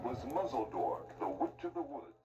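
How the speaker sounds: ordinary delivery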